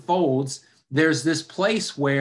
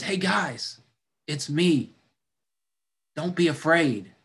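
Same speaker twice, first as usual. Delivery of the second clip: gentle, reassuring call over the water; calm them down as a friend; Jesus reassuring; 3 semitones higher than usual. Acted. Jesus calming frightened disciples.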